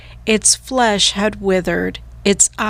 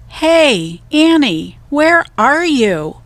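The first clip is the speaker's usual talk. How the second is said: higher, louder call down an empty hallway; 7 semitones higher, 4 dB louder, slower than usual. A narrator's reading. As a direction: call out, slow and searching. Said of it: "he said".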